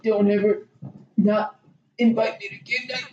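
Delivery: halting, broken-up dying plea; mock dying man. Imitating Gary's last request to friends, halting mock dying voice. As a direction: gasp out the words piece by piece. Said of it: "he's dying in their arms".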